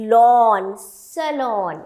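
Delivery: "lawn" and "salon" with a wide aw; open-mouth pronunciation. Shown as a demonstration. Teacher modelling the open mouth, open-mouth pronunciation. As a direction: open fully on "lawn", then say "salon" as suh-lawn. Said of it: "मुंह को पूरा खोलना है".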